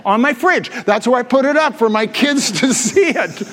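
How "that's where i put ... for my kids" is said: ordinary talk, nothing marked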